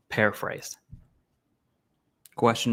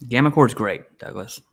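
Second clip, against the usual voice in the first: usual speaking voice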